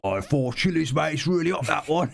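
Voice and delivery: put-on light London server accent. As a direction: say it like a London waiter. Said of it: "that proper London accent".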